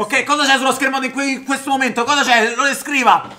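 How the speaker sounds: high, loud demanding questions; exasperated shouting. Pretend. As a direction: press him, exasperated and loud. Exasperated quiz of Vito.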